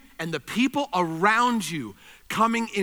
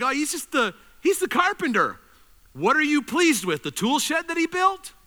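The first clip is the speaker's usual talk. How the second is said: dismissive neighbours; 6 semitones higher than usual. Retold or imitated. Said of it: "to the people in the neighborhood, it's like".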